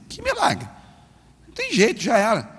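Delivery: high incredulous scoff; mock the idea of a miracle; scoffing doubt. Young man doubts the miracle, high-pitched scoff. Skeptical inner thought.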